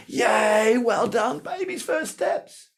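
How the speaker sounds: high, excited parent cheering. Retold or imitated. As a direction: cheer the baby's first steps, way up high. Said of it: "we're like".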